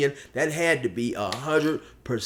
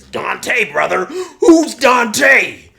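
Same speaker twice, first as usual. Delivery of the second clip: baffled bro building to a high shout; Polar Bear; 10 semitones higher, 10 dB louder, much slower than usual. Acted. Buddy hearing of a new name, building baffled shout. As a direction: demand it louder as it goes. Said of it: "Polar Bear says".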